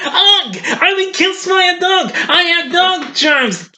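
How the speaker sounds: hammed-up high yell about dog germs; over-the-top dying yell. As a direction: scream it like you've been poisoned by dog germs. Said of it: "I got carried away".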